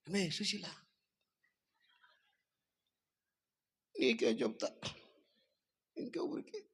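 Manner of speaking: high, soft confiding gossip; gossiping wife. Acted. Wife confides the secret to her friend Sushila, high and hushed. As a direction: lean in and spill the secret.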